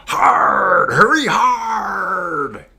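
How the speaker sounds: long, high bellowed calls to sweepers; curling sweep shout. Acted. Curler to the sweepers as the stone slides, loud drawn-out yelling. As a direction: holler it across the ice, vowels stretched out. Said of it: "where they throw the stone down the ice and you sweep".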